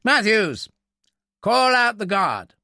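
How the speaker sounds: loud, high military summons; calling out orders. Acted. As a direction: call the order across to a subordinate. Officer calls an order to subordinate.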